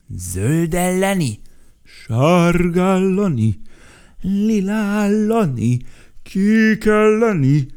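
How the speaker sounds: high, loud, slow mocking echo; mocking repeat. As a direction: throw his words back, ridiculing. Mocking the boy's chant back.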